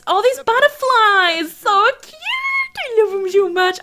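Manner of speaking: high-pitched cutesy gushing. Real, not acted. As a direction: squeal with adoration over something adorable. Gushing over cute butterflies.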